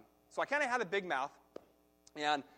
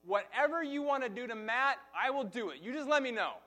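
livid dad; 9 semitones higher, 7 dB louder than usual. Retold or imitated. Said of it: "he says to my brother".

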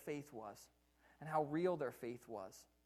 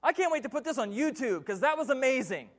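mock amazement; 9 semitones higher, 17 dB louder than usual. Disciples gushing about the miracle, hammed-up amazement. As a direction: gush like a thrilled fan. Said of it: "Great amazement".

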